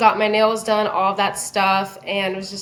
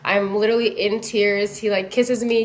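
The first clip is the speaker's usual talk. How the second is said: usual speaking voice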